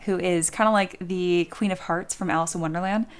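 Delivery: usual speaking voice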